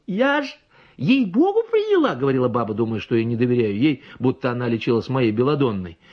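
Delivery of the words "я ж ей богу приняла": high, sing-song protest; insistent peasant woman. A narrator's reading. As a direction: swear it earnestly.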